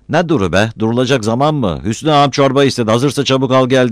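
loud, high-pitched impatient hollering; shouting. A narrator's reading. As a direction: shout so the neighbours hear. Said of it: "Battal öyle bir bağırıyordu ki".

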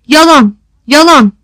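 full-voiced shout. A narrator's reading. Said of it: "diye bağırdı bütün sesiyle".

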